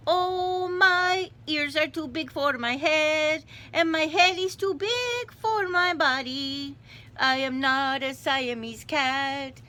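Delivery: put-on high, loud singsong Spanish-accented kitten. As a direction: proclaim it in a playful Spanish accent. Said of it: "Spanish accent, he said".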